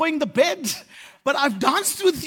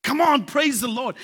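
usual speaking voice

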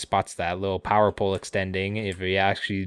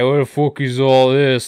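Ox King voice; 4 semitones higher, 7 dB louder than usual. Retold or imitated.

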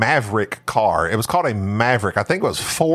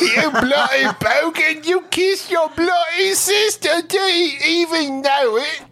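put-on Aussie accent. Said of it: "drinking Foster".

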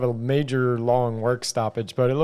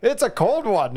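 hammed-up Canadian accent, pitched higher; goofy mock Canadian remark on the weather; terrible Canadian accent; 7 semitones higher than usual. Tight end joking for the camera, higher hammed-up Canadian accent. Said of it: "this terrible Canadian accent".

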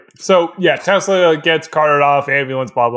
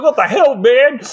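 higher-pitched startled objection; mock protest; 7 semitones higher than usual. Random guy with Hardin in his face, startled protest. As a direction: protest like an alarmed bystander. Kissed bystander confronted in retelling.

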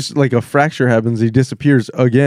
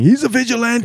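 mock J. Jonah Jameson; 9 semitones higher than usual.

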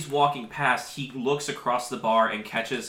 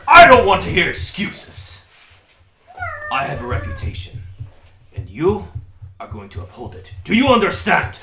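roaring dwarf rage, much louder; furious dwarf; 10 semitones higher, 11 dB louder than usual. Acted. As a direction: bellow him down. Dwarf berating scared boy.

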